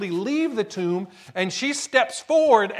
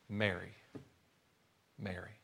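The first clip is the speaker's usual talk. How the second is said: tender hush; 11 semitones lower, 15 dB quieter than usual. Retold or imitated. Jesus speaks her name.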